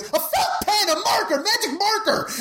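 high cracking near-shout; imitating a panicked lawyer losing it; voice breaking. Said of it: "his voice breaks just like that".

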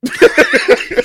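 loud, breathy burst of real laughter. Real, not acted. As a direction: crack up loud at your own story. Laughing at her own story.

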